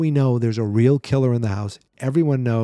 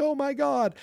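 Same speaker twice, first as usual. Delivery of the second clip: hammed-up shock at a much higher pitch; mock horrified exclamation; 14 semitones higher, 3 dB quieter than usual. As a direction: imitate a horrified movie character. Retelling a frightened character's exclamation.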